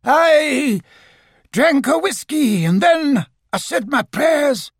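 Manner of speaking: high wailing echo of the answer; ghostly. A narrator's reading. Fake ghost repeating what Owl prompted, ghostly. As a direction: stretch it out like a phantom. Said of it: "said the voice".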